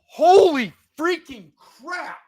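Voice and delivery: high, loud and slow exclamation; drawn-out furious shout; outraged outburst. Real, not acted. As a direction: explode in real disbelief. Outburst after another bad play.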